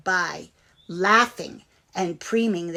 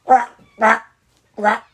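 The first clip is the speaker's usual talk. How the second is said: frog croak; 2 semitones higher, 10 dB louder than usual. Acted.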